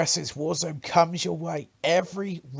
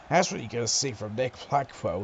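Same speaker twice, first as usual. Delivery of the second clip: ordinary talk, nothing marked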